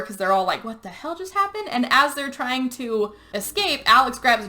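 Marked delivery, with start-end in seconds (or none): 0.6-1.7 high, hushed mock alarm; lightly acted bewildered panic; freaking out. Friends retold trying another door, in high-pitched alarm. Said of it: "they're all freaking out for a second".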